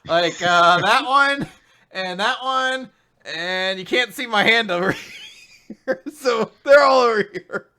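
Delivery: fake-cry over your pretend friends; mock sobbing. Joking to the co-host about all his girls, hammed-up mock sobbing.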